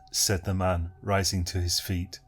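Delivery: ordinary delivery, nothing marked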